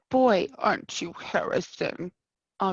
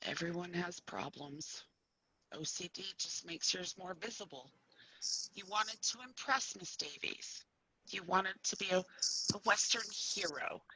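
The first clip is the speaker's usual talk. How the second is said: gentle reassurance; 3 semitones lower, 9 dB quieter than usual. Acted. Doctor comforting patient softly.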